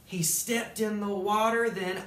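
lively storybook narration; animated read-aloud; reading to children. A narrator's reading. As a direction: tell it like a bedtime story to kids. Reads Dr. Seuss story aloud.

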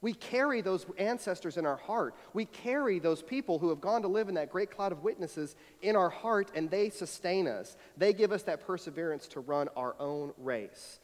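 normal talking voice

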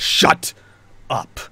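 harsh clipped shut up; glaring snap. Acted. Knight snapping at Finn, angry. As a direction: cut them off cold. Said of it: "turns to you with a glare".

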